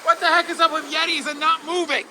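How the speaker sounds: high, exasperated questioning; incredulous complaint. Real, not acted. Rider puzzled by Yetis not moving, high incredulous complaint. Mock outrage at still Yeti figure.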